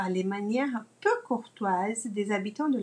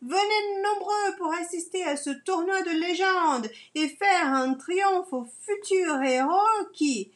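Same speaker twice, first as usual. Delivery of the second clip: royal herald; 4 semitones higher than usual. Acted. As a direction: proclaim it to the whole town square. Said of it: "Coq Papy est crieur public".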